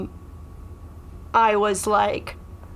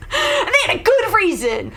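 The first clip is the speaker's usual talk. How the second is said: high laugh breaking into the words; laughing hard; 12 semitones higher than usual. Real, not acted. Comedian cracking up at her own joke about her partner. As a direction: laugh through it, nearly crying.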